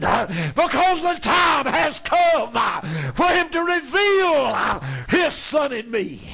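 shouted, rising preacher's climax; fiery preaching. Real, not acted. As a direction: build to a hollering peak. Preaching at full force.

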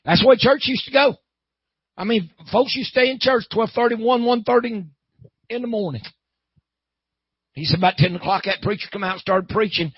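high, loud revival preacher; fired-up preaching. Real, not acted. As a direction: exhort the congregation with force. Preaches to the congregation.

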